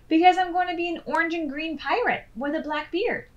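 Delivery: put-on high, fast, excited little-girl voice; eager pirate girl. Hip tells Hop about her pirate costume, high and quick. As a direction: rush it out like a proud kid with a plan.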